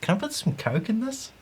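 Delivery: high, soft novice question; imitating a clueless first-timer; naive beginner. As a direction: ask timidly, pitched well up. Retelling his naive beginner's request.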